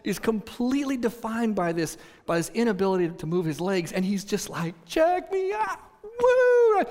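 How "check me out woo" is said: very high, whooping glee; elated showing off. Retold or imitated. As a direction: crow with delight like a man just healed. Voices healed man showing off.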